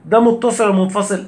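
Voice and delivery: ordinary delivery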